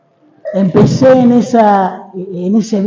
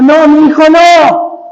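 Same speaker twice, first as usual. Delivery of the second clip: raised, drawn-out mock wail of a desperate mother; madre llorando, imitada; 6 semitones higher, 4 dB louder, slower than usual. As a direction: cry out like a pleading mother, louder and higher. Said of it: "lloraba la madre diciendo". (grito imitado de madre desesperada)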